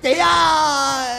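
hired mourner calls out to the grieving woman; drawn-out shout. Acted. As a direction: call her name long and loud. Calling out again.

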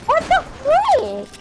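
high, slow, stretched shock; shocked squeal. Real, not acted. Gamer yelps at teammate's sudden kill, shocked. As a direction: yelp it in disbelief.

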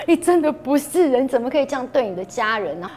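half-cry at yourself alone in the room; retold crying reproach. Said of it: "我就在房间里面自己哭". (在房间里哭着责骂自己，略带哭腔)

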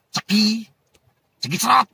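high, sudden playful squawk; teasing call-out. Real, not acted. Speaker taunts his brother Cemani, high teasing call-out. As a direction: toss it out high and punchy as a tease. Playful catchphrase aimed at rival, then laughs.

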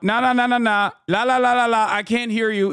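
put-on a kid covering his ears, taunting; childish refusal. Mocking Ben plugging his ears, singsong taunt.

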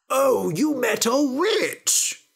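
high, slow, delighted cry; father crying out. Acted. Father thrilled Jack met a witch, high excited cry. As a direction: gush with delight at the news. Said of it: "cried Jack's father".

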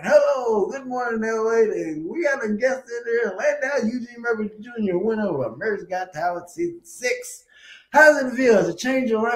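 mock TV host. Imitating the morning show host.

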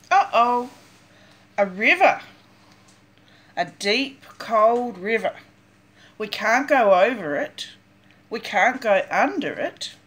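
ordinary delivery

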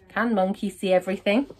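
ordinary delivery, nothing marked

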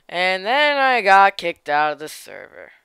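ordinary talk

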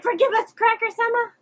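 high, loud mock begging; mock pleading minions. Imitating minions begging Cracker's forgiveness, mock pleading. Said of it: "They're like".

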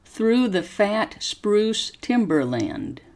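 slow, careful story reading for students to repeat; slow model reading. Shown as a demonstration. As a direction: pause after each phrase and lift the tune clearly. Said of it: "Copy how my voice goes up and down".

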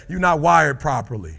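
loud shouted verdict; preaching at full force. Acted. Speaker scolding the crowd, shouting. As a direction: hit them with the truth.

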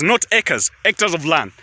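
ordinary talk

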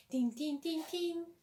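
sung din din of the opening music; a little hummed jingle; hummed tune. Retold or imitated. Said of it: "Öyle de müziği var".

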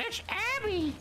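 very high mock shout of alarm; mimicked high-pitched sighting call; imitated enemy alert. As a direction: squeal it like a guard spotting someone. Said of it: "the guy's like".

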